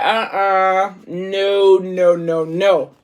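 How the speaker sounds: refusing. Retold or imitated. Reader refusing to read on, a mock firm no. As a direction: push the story away, no no no.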